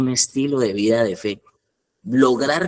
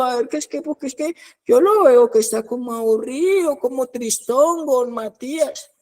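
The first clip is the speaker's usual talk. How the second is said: high, whining voice of a worried mother; pleading mother; 13 semitones higher than usual. Retold or imitated. Worried mother writes to don Matías about her son, high pleading whine. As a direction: play the fretting mom begging don Matías, pitch way up. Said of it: "a cada rato me escriben".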